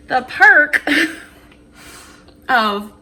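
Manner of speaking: laughing through the words; giggling between words. Real, not acted.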